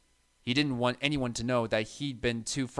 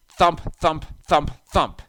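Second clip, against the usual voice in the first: thumping noise; 3 semitones higher, 8 dB louder, slower than usual. A narrator's reading. Noise coming closer.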